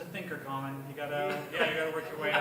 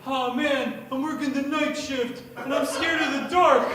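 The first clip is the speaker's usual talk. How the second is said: put-on kid voice; 5 semitones higher, 5 dB louder than usual. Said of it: "He's like".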